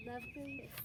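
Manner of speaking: plain reading voice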